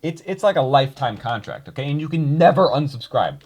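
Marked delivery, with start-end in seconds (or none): none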